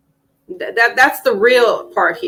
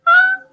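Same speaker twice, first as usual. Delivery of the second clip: very high squeal of delight; real high gasp of delight. Real, not acted. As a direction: squeal with joy. Gushing about the video.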